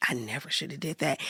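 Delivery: low, hushed regretful aside; rueful aside. Real, not acted. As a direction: drop right down and admit it. Rueful aside after retold outburst.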